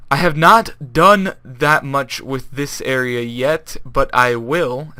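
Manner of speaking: slow, careful, every word laid out; speaking correctly. Shown as a demonstration. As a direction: talk it slowly and properly, like a commentator. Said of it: "I really need to talk like that".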